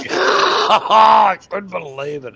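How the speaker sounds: high, loud, slow words through laughter; laughing disbelief. Real, not acted. Laughing at his own story.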